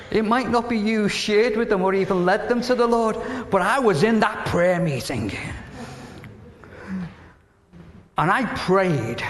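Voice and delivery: preacher's proud testimony, voice lifted; impassioned preaching. Real, not acted. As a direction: drive it home to the congregation. Preaching exhortation to the congregation.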